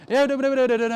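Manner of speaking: high-pitched mock gibberish of Italian doctors; Italian doctors' poor English. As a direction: babble like a doctor with little English. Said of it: "they don't speak so good English".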